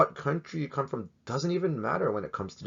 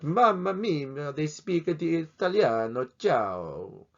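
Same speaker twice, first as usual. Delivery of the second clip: mock Italian accent; 6 semitones higher, much slower than usual. Joking about Italians speaking Italian.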